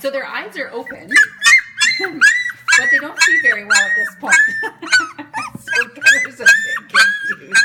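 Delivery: words squeezed out through loud high laughter; talking through a loud giggle fit; laughing while talking. Real, not acted. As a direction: try to explain but keep cracking up. Explaining while still laughing.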